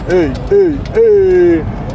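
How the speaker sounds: calling out. Real, not acted. Calling out greeting after stage.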